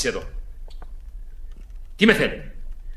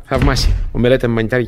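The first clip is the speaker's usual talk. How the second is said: ordinary delivery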